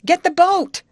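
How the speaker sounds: high, loud shouted order; urgent high holler; calling out. Acted. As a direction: yell across the water to the youth. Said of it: "she called to the ragged youth".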